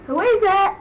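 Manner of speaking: loud high call through the door. Retold or imitated. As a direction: shout the question from another room. Calling out from another room.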